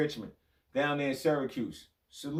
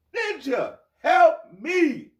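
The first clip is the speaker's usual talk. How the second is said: high, loud, squeaky panicked plea; Kevin Hart impression; 10 semitones higher, 8 dB louder, much slower than usual. Ranting ex-player mimics Kevin Hart begging for help, high and loud. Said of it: "Kevin Hart said".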